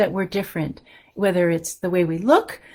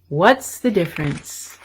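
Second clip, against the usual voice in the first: put-on reading to children. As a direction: read it warmly to little listeners, letting each line land. Reads picture book to children.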